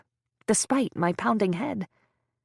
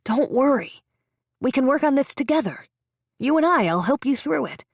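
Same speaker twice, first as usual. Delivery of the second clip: brighter, higher reassurance, a little fast; reassuring man; 5 semitones higher, 4 dB louder than usual. A narrator's reading. Man at the bedside soothing the patient, eager and reassuring. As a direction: soothe her too eagerly. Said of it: "he adds".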